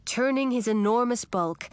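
usual speaking voice

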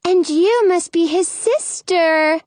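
tiny faraway voice. Acted. The teeny tiny fairy.

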